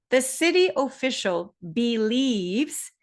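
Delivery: slow news-report example with "believes" drawn out; stress on BELIEVES. Shown as a demonstration. Teacher demonstrating a signal word from a news report, slow emphatic "believes". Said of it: "I'm going to demonstrate one example of a signal word".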